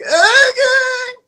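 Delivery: squealed okay, shooting very high with a cry; crying squeal of surrender; overwhelmed okay. Retold or imitated. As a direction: yelp out the okay, cornered and giving in. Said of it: "And I was like".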